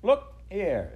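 high, loud indignant outburst; cross creature demanding attention; furious. A narrator's reading. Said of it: "The Samian was furious".

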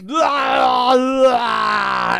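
very loud, high drawn-out yell; bellowed action-hero agony, hammed up; two long mock roars; Schwarzenegger. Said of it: "looks at Batman and goes".

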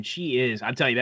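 ordinary delivery

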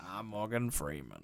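low, quiet imitation of a deep actor; Morgan Freeman. Co-host naming himself as the actor, low and soft. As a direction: introduce yourself in a bad deep impression. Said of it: "our bad Morgan Freeman impressions".

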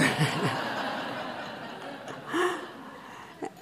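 breathy high laugh. Real, not acted. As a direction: laugh at her own punchline. Punchline of the joke.

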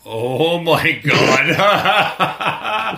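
high, loud, laughing disbelief; laughing exclamation. Retold or imitated. Fan recalling his reaction, laughing loudly. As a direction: gasp it out through laughter. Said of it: "He's like".

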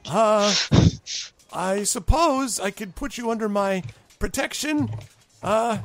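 high, halting official offer; little dignitary unsure of his authority; hesitant goblin ambassador. Acted. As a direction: offer it uncertainly in a high pompous voice. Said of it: "he kinda looks behind him".

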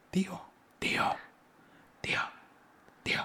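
whispered call into the house; susurro. Retold or imitated. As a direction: call the uncle in a hushed whisper. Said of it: "¿Así o, o en voz normal?". (llamando al tío en susurros)

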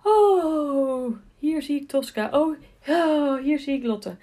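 light, high relieved horse thoughts; happy horse voice. Pretend. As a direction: voice the horse spotting its rider, lightly. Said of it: "meteen kan denken".

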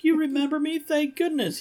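very high, mock-relieved voice; hammed-up relief. Cartoon man to the robot Roomba, high hammed-up relief. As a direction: squeak out exaggerated hopeful relief.

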